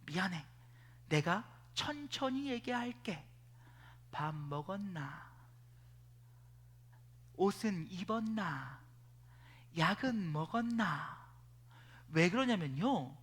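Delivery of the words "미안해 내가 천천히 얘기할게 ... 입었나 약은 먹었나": soft, slow and tender in Gyeongsang dialect; man gently checking on the woman he likes; gentle apology. Retold or imitated. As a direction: ease off and speak softly to calm her. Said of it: "어떻게 하냐면".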